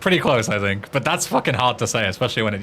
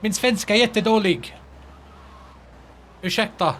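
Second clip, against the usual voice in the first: higher, non-native Swedish; poor Swedish accent; 7 semitones higher than usual. Shown as a demonstration. Streamer apologising in Swedish for the accent, non-native Swedish. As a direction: apologise in wobbly learner's Swedish. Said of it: "My, my Swedish accent's kinda shit".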